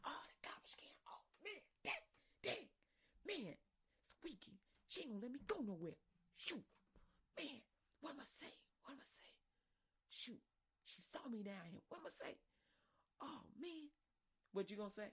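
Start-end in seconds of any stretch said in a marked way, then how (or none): 0.1-0.9 hushed panicked whisper. Retold or imitated. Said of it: "You're like". 1.1-13.9 softer, higher worried muttering; worried kid. Retold or imitated. Kid panicking, softly.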